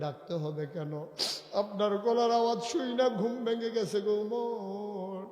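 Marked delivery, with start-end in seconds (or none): 1.6-5.2 impassioned appeal. Acted. Workers roused from sleep addressing Umar, impassioned appeal. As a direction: lift the voice and stretch the name. Workers plead, voiced in raised chant.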